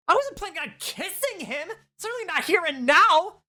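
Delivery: put-on high, flustered girl's voice; embarrassed teen insisting she won't kiss him. Teen girl character voice.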